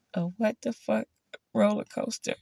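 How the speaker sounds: ordinary delivery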